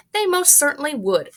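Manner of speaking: high indignant retort; indignant cry. Acted. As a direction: insist indignantly. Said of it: "cried Missus Gloop indignantly".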